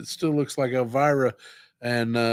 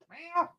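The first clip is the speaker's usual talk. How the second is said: cat meow; 9 dB quieter than usual. Pretend. Calling the cat with meows.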